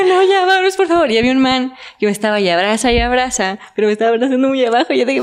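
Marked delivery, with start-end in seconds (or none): 0.0-1.1 pleading high. Retold or imitated. Begging to leave, torn pants.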